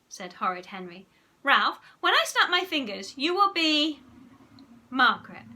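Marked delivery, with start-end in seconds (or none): 1.4-5.4 loud, high showman's command; commanding hypnotist. A narrator's reading. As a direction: announce the trick like a stage hypnotist. Henry commands his mock hypnosis subject.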